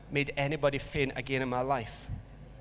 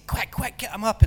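urgent hurry; 6 semitones higher, 4 dB louder than usual. Retold or imitated. Sneaking fainted boy past teacher.

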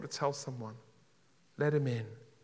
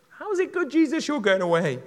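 puzzled disciple; 11 semitones higher, 11 dB louder than usual. Acted. Disciples question Jesus, acted.

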